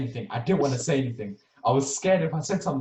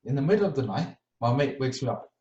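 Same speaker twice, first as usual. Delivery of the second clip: normal talking voice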